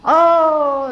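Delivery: loud, high drawn-out "oh"; sudden realisation. Retold or imitated. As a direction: let the penny drop, loudly. Sudden realisation in retold confrontation. (seruan tersadar)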